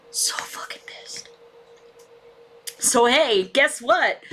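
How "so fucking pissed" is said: softer, angry whisper; real seething whisper; whispered fury. Real, not acted. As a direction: hiss it under your breath.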